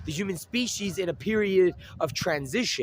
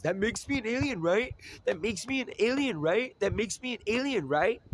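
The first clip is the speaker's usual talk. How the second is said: mimicked claim; 6 semitones higher, 4 dB quieter than usual. Mimicking someone's repeated claim.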